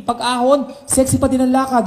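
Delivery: ordinary talk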